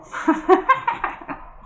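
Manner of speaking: airy, high-pitched giggle; breathy high giggle. Real, not acted. Host giggling at his own "tout est grand" joke, airy and high.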